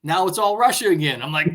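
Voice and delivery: ordinary talk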